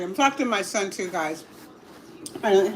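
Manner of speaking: ordinary talk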